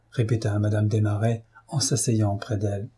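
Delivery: ordinary delivery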